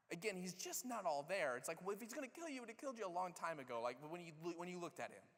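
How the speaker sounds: ordinary talk, nothing marked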